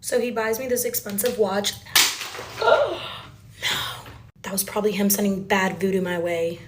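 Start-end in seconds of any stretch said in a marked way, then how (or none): 1.8-2.5 sudden sharp intake of breath; real startled gasp. Real, not acted. Startled interruption during the story. 2.6-3.0 very high, louder startled no; real high-pitched dismay; startled cry. Real, not acted. Startled reaction right after gasping.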